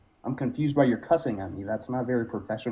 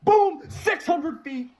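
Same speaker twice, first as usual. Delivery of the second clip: high, loud triumphant cheer; excited shout; 5 dB louder, much slower than usual. Real, not acted. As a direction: crow over a game-winning hit. Celebrates hit in baseball game.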